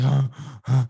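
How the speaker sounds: imitating panting gasps; mock breathless panting; out of breath. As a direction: puff like you just swam a lap. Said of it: "I was out of breath".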